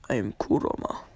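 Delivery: Kurama. Acted. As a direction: drop well down for a caged beast's introduction. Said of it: "And he says".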